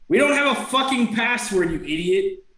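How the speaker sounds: high-pitched squabbling redcap; yelling back. Acted. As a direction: yell back like an irritated little creature. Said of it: "you hear another one yell out".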